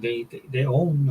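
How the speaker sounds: ordinary talk, nothing marked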